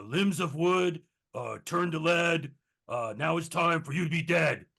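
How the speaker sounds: spell incantation. Acted. Player's character curses the tree monsters, loud chanted spell. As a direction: declaim the curse at full voice. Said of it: "and he's like".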